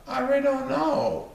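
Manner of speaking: lightly voiced high salesman; salesman's voice. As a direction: salesman baffled by the car. Mister Sandy answers.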